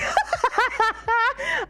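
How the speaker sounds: high, rapid giggling burst; real high-pitched laugh; burst of laughter. Real, not acted. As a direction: crack up in a high cackle. Laughs at own accent practice.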